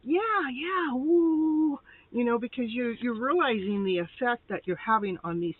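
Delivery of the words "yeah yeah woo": high, lively whooping cheer; excited cheering. Retold or imitated. Person enjoying their effect on people, cheering high and bright. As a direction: cheer like the crowd just loved it. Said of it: "You're going like".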